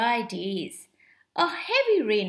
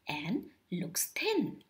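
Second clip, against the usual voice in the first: breathy storyteller whisper; a soft whispered aside to the children; 7 semitones lower, 7 dB quieter than usual. A narrator's reading. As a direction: whisper "and looks thin" as if the cactus is wasting away. Reading the cactus shrinking.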